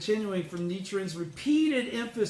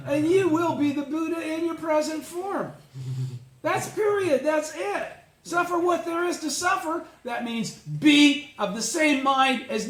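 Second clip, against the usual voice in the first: fervent preaching; 7 semitones higher, 4 dB louder than usual. Real, not acted. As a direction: pitch climbing much higher and louder with conviction. Preacher rising to fervent climax.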